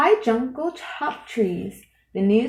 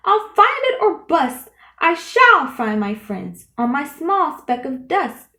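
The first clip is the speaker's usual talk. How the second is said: much louder, higher determined cry; cried; 6 semitones higher, 5 dB louder than usual. A narrator's reading. Horton crying after the eagle, loud and high with resolve. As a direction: cry it out, refusing to give up. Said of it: "cried Horton".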